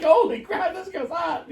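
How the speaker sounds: high, laughing burst of mock amazement; excited exclamation. Friend admits what they have said about a girl, laughing excitedly. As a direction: blurt it out high through laughter. Excitedly quoting own past reaction.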